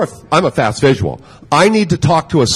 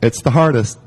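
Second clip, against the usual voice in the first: ordinary talk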